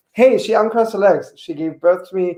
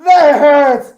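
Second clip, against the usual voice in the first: pained yell; 8 semitones higher, 8 dB louder, slower than usual. Retold or imitated. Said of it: "He said".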